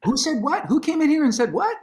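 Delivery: high, loud, incredulous demands; indignant outrage. Retold or imitated. Riled up at management's rule, high indignant outrage. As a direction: play up the disbelief at the studio rule. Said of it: "And I go".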